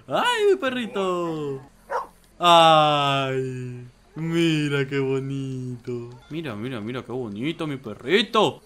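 slow cooing to a puppy. Real, not acted. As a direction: coo lovingly at the pet. Cooing over a dog. (voz lenta y cariñosa de mimo)

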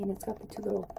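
ordinary talk

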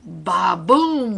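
slow, loud drum-like boom; storybook crash effect; band's boom. A narrator's reading.

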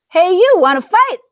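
high, loud, challenging shout; high grouchy shout. Acted. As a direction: yell up at the giant whale. Grouchy ladybug picks a fight.

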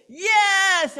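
loud, high yelled yes; crowd roar. Retold or imitated. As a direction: roar back. Crowd answers.

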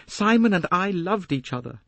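high, quick-flowing declaration; Jacqueline, impassioned. Acted. Young woman tells the detective of her romance, impassioned high voice. First take, before repeating with emphasis.

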